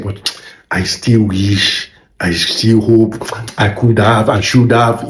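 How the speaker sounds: put-on regretful old person, slow and low; wistful regret. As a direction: mutter old regrets from a rocking chair. Regretful old self voiced.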